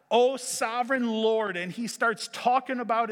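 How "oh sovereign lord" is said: louder, higher, frustrated cry upward; yelling at God. Acted. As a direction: call out in exasperation to God. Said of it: "He is yelling at God".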